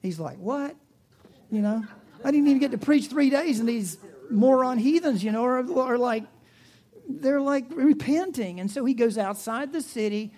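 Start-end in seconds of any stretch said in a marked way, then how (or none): 0.4-8.6 higher, louder, fast indignant complaint; exasperated outburst. Acted. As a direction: rant like a prophet robbed of his sermon. Exasperated Jonah complains to God.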